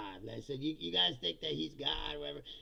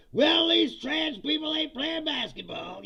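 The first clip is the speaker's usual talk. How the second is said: higher, louder, slow mocking drawl; mocking dumb voice; 8 semitones higher, 7 dB louder, slower than usual. Streamer mocks voters ignoring nukes, slow dim-witted voice. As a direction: play a clueless moron, slowly. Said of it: "and go".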